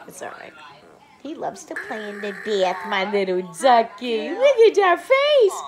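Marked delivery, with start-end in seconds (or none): 1.2-5.6 high, loud cooing with squeals. Real, not acted. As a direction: gush over the little duck. Cooing to baby in bath.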